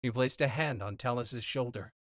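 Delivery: ordinary delivery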